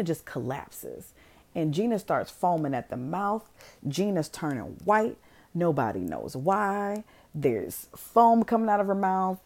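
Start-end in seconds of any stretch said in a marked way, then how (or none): none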